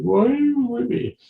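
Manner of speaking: the sad dog pleads as its owner leaves, high and whiny; pleading dog voice. Retold or imitated. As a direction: voice the dog begging you to stay. Dog's sad look voiced.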